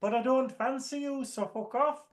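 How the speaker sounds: high, curt put-on brush-off; high mock-sassy rejection; sassy rebuff. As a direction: dismiss him with a high sassy voice. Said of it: "And she's like".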